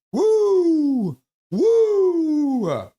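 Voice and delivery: loud, high, long whoop; cheering whoop. Acted. Streamer joining viewers on the wave, loud whoop. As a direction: whoop high and let it swoop down.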